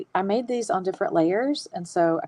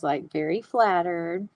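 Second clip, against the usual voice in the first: ordinary delivery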